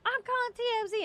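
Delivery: high-pitched imitated threat; mock yelling. Passenger filming them and threatening T M Z, mock yelling. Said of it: "and she's yelling".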